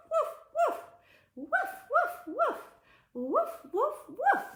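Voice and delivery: high, loud woofs; playful high-pitched barking; dog barking. Shown as a demonstration. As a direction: bark like an eager dog. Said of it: "we can do the dog".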